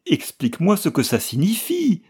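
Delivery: high, loud desperate demand; desperate cry. A narrator's reading. Wife demanding the truth from her husband, desperate loud cry. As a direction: cry out for an answer in despair. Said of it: "s'écria-t-elle désespérément".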